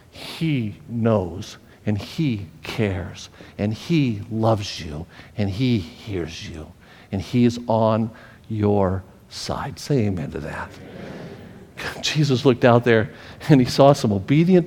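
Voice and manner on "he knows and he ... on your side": slow, soft, tender assurance; tender reassurance. Real, not acted. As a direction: comfort the hurting gently. Tender preaching.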